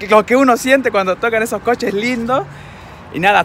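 ordinary talk

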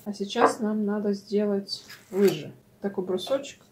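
normal talking voice

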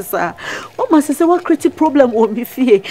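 ordinary talk, nothing marked

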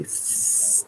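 long hissed "S-" sound; drawn-out s like a snake. Shown as a demonstration. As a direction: hiss "S-" like a snake. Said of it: "don't spit when you do it".

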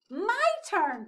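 high, loud eager little penguin; excited cry. A narrator's reading. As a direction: pipe up eagerly for a turn. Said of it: "cried Flip".

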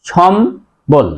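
সম্বল said slowly in two parts, shom, then bol; teacher sounding out সম্বল syllable by syllable; careful correct pronunciation. Shown as a demonstration. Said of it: "উচ্চারণ হবে কি?".

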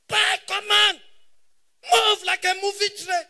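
loud, high shouted order; comic command. Pretend. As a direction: order it like a drill sergeant.

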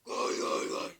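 loud rasping goal call; screaming football commentator. Acted. Goal scored.

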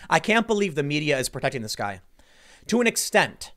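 usual speaking voice

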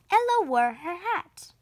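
ordinary delivery, nothing marked

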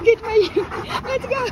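loud, high urging call; high, forceful go call. Real, not acted. Urging the dogs to get moving.

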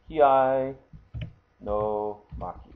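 Kiai held out, pause, then a slow no maki; slow, separated repeat. Shown as a demonstration. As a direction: sound out the term slowly, part by part. Repeats term slowly, word by word.

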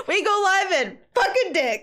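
helpless high giggles breaking up the words; laughing to tears. Real, not acted. Repeating the botched podcast plug, laughing to tears. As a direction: say it while cracking up completely. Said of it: "I'm crying a little bit".

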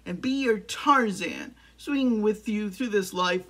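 building romantic declaration. Acted. Suitor vowing to be Jane's Tarzan, loud and grandly declaring. Climax of acted love declaration.

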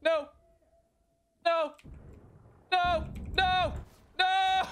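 high, pleading repeated no; desperate no. Real, not acted. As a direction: cry no in despair. Teammates dying in game.

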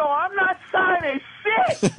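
high, slow defiant refusal; mock defiant refusal to the officers. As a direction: dig in and refuse to sign.

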